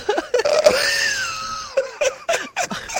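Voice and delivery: laughing so hard it turns to a shriek. Real, not acted. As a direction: laugh until it screams. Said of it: "I'm fucking crying".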